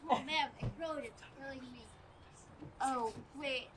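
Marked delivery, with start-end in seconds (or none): none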